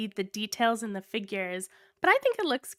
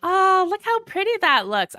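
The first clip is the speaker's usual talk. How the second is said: excited; 8 semitones higher, 6 dB louder than usual. Real, not acted. Admiring figure up close.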